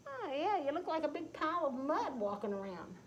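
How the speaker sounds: sly cat, mocking. Cat teases Tom.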